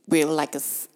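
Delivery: normal talking voice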